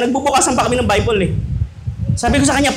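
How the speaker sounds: ordinary talk, nothing marked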